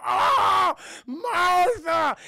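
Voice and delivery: high, loud hammed-up anguished old man; mock grief cry. Old man crying out for his dead wife, hammed-up. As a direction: wail it like an overacted soap husband.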